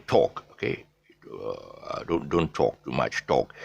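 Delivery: British "talk" with a rounded "tawk". Shown as a demonstration. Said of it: "But the British".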